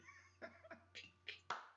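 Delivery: laugh. Real, not acted. Laughs at own story.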